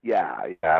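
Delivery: real tears choking the voice, barely voiced whisper; whispering through tears, overcome; tearful whisper. Real, not acted. Moved to tears about career.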